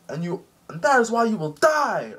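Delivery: high, hammed-up death threat; villain's threat. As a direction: build to a theatrical cry of doom. Akainu threatening while punching.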